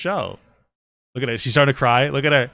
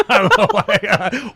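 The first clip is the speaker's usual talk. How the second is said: high laughing words, slowed; 12 semitones higher, 9 dB louder, slower than usual. Real, not acted. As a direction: laugh through the words, much higher. Laughing at his own remark.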